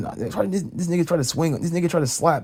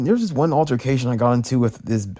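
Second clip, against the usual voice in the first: ordinary delivery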